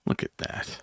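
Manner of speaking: whispered judging aside; whispering. Shown as a demonstration. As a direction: whisper it behind your hand. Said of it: "or I'll go like this".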